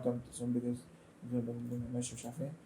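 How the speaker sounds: low, soft, slow mumble; muttering to self. Real, not acted. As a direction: trail into a quiet aside while busy working. Talking to self while adjusting shape. (يتمتم لنفسه بصوت واطي)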